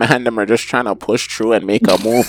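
mock Toronto slang accent; exaggerated Toronto accent, imitating slang. Shown as a demonstration. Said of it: "stereotypical Toronto slang".

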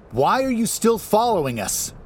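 high and loud, calling out. A narrator's reading. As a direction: call it out across a hall. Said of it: "his voice echoing around the space".

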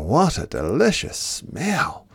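ordinary delivery

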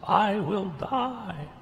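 high, very slow mock despair; dramatic Jonah. Preacher mimicking Jonah's overdramatic despair, slow. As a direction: drag out a sulky "I will die". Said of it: "as Jonah does".

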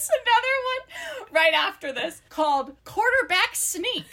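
high, squeaky talking through laughter; real helpless giggles. Real, not acted. Host announcing the next silly book title, helplessly giggling. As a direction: fight real giggles while speaking.

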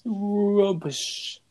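British "Rubbish" said high, with a long fall; British accent. Retold or imitated. Echoing a friend's British word, in a British accent. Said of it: "the British accent".

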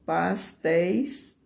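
"pastéis" drawn out with a rising end; slow, leading "pastéis", left hanging; slow dictation. Shown as a demonstration. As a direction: stretch "pastéis" slowly for pupils to write. Teacher builds the diminutive for pupils.

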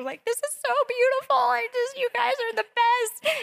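high, fast teary gushing; a hammed-up teary rush of praise; mock sobbing gush. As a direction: imitate yourself blubbering through happy tears. Imitating herself sobbing at wedding.

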